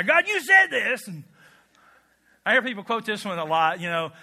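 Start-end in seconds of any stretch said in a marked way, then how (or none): 0.0-1.1 loud, high, slow mock demand; bullying God. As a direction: throw His words back at Him, hammed up. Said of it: "how we bully God".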